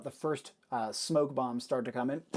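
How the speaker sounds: ordinary talk, nothing marked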